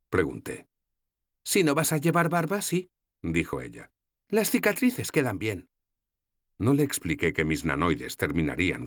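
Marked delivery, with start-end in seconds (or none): 1.4-2.9 put-on higher, lighter young woman's voice; voiced young heroine, gently teasing; Sefawin's voice. 4.3-5.7 put-on higher, lighter young woman's voice; voiced young heroine, gently teasing; Sefawin's voice.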